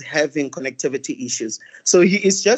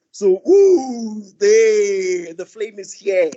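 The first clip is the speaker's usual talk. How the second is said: excited "ooh", higher and louder, thrilled to be on time; 4 semitones higher, 8 dB louder, much slower than usual. Real, not acted. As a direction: let it burst out with glee. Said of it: "Am I not excited?".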